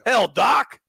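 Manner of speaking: loud, high call-out; excited shout. Real, not acted. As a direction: yell his name in delight.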